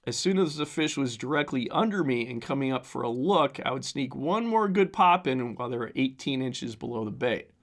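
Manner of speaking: ordinary talk, nothing marked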